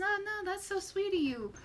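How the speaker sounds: half-hearted attempt at the character's accent; NPC accent attempt. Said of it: "I know I'm supposed to be doing an accent".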